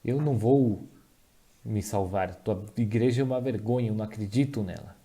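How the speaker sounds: usual speaking voice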